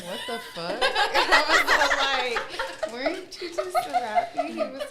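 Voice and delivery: high, helpless real laughter; laughing. Real, not acted. Storyteller retelling her Santa Claus mistake, laughing. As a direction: struggle to tell it through laughter.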